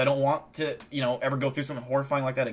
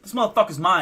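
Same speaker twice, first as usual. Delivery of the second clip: higher, louder brash greeting; cocky alien; 4 semitones higher, 5 dB louder than usual. Retold or imitated. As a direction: burst in like a party bro. Voicing alien grabbing scalpel.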